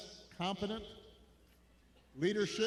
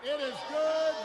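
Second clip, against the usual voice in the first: shouting; 6 semitones higher, 7 dB louder, slower than usual. Real, not acted. Shouting over crowd.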